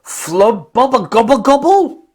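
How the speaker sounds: high, loud babble; babbling gibberish. A narrator's reading. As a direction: spout nonsense words. Gibberish new language.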